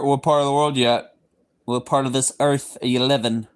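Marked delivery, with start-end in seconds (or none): 1.6-3.5 a goofy put-on voice, lightly done; weird voice. Asking a viewer where they live, goofy put-on voice. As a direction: twist the question into a silly character voice. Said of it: "Forgive my weird voices".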